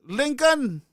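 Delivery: high, loud call of the name. Real, not acted. Host calling for a missing caller, calling out. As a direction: shout for him across the airwaves.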